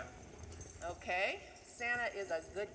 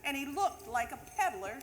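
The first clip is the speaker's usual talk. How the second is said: lively storybook reading of a Christmas poem; a teacher reading The Night Before Christmas to the class; reading to children; 3 semitones higher, 3 dB louder than usual. Acted. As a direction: read it like a bedtime story to kids.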